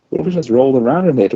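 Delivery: fast, high, giddy rush. Acted. Sister giddily imagining fun with chocolate. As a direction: tumble it out excitedly.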